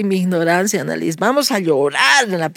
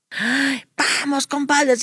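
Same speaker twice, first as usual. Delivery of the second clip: high, eager summons; retold excited call to companions; acted rallying call; 8 semitones higher, slower than usual. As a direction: round up your little gang. Acts out rallying her companions.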